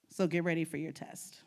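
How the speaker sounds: ordinary talk, nothing marked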